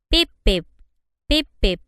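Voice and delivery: loud clipped beeps; imitated warning beep, loud; beeping signal. A narrator's reading. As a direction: mimic an alarm going pip pip.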